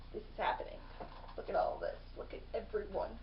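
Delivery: hushed, breathless wonder; awed whisper. Retold or imitated. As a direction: whisper it as if staring at something huge. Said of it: "the one looking around going".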